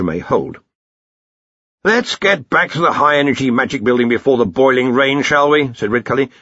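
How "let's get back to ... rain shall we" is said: put-on high, hearty old wizard voice; blustering archchancellor. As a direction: boom it out like a bluff old boss. Ridcully decides to leave.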